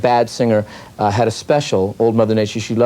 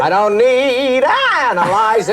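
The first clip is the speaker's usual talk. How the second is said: [name] impression; 10 semitones higher than usual. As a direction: belt it like Merman. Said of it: "a little bit of Bert Lahr in there".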